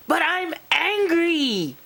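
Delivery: very high-pitched cross protest; hammed-up childlike indignation; angry little fairy. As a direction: whine it angrily, way up high. Posh protests after being scolded.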